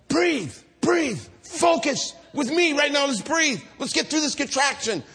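high, emphatic birth-coach commands; urgent coaching. Retold or imitated. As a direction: push her through it like a coach.

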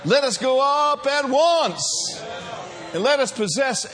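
loud, high and stretched out; rallying shout. Real, not acted. Rallying the congregation to advance.

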